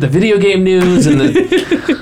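laughing mock-complaint. Real, not acted. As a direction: tease him while giggling. Mock-roasting departed cohost.

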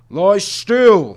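loud, high shouted command; shouting. A narrator's reading. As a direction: bark it over the struggle. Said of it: "the tiger shouted".

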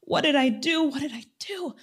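high, panicky pleading; rising panic, repeating the question; panicked distress. Retold or imitated. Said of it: "I broke down crying".

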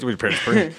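rapid sped-up disclaimer; auctioneer. Shown as a demonstration. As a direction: rattle it off like an auctioneer. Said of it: "Really quick, sped up, like, auctioneer voice".

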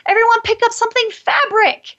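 put-on high, fast, bright game-host voice calling out to the kids; playful game-leader call. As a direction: sing it out like a fun game. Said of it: "you make it a game".

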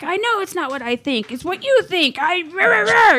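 shrill-pitched mocking rant, building to a rah rah; mock outraged retort. Wife retelling her jealous retort to husband, high mock outrage.

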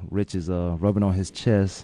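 low, slow teasing sexy voice. Real, not acted. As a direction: tease the co-host in a slow, low purr. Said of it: "hear my sexy voice".

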